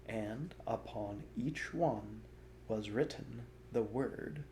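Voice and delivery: ordinary delivery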